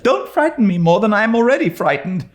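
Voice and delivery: put-on high, fast pleading girl; imploring. A narrator's reading. Anne to Diana, imploring quickly. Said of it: "implored Anne".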